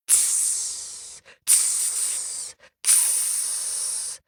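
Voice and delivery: soft unvoiced goose hiss. A narrator's reading. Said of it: "hissed at Vasilisa".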